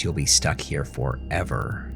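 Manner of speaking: ordinary delivery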